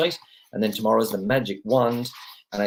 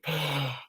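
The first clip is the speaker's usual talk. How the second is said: imitated gasp; 5 semitones higher, 7 dB quieter than usual. Imitating people surfacing.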